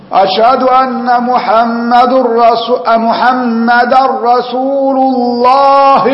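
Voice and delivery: أشهد أن محمد رسول الله chanted slowly and high, stopping on a voiced vowel; stop with vowel. Shown as a demonstration. Teacher demonstrating a wrong stop at the end of the call, slow chant. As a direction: end the final word still voiced when chanting slowly. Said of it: "زي إيه".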